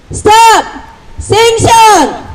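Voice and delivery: high, drawn-out rally cry; 구호 외치기. Real, not acted. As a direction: call it out slowly as a call-and-response slogan. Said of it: "다시 한번 해보겠습니다". (길게 끌어 외치는 구호)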